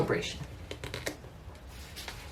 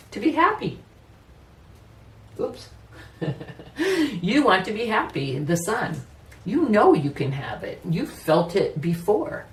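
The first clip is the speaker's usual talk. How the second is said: usual speaking voice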